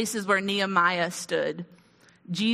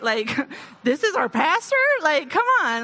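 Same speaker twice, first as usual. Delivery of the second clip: laughing; 5 semitones higher, 4 dB louder than usual. Pretend. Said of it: "I know, right?".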